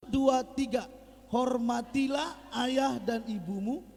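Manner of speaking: slow, loud unison reading of the verse; group reading aloud. Real, not acted. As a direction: read it out together, slowly and loud. Congregation reads scripture aloud together.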